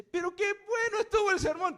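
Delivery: high, loud, delighted exclamation; gushing praise, imitated. Someone praises a dull sermon, high loud delight. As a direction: rave like a thrilled churchgoer.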